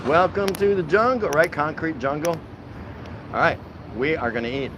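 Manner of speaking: normal talking voice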